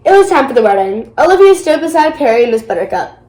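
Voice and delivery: ordinary delivery, nothing marked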